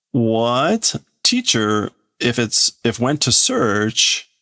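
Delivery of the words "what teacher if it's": plain reading voice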